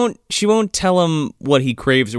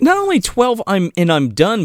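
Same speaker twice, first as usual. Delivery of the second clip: ordinary talk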